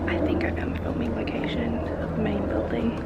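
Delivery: whisper. Real, not acted. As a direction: whisper it, awed. Inside quiet university building.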